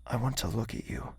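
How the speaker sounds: whispered plea; tender whisper. Acted. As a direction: ask gently in a hush.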